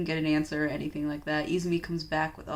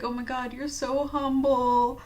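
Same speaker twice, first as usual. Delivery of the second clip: mock gushing; 5 semitones higher, 3 dB louder than usual. Mock gushing at humble girl.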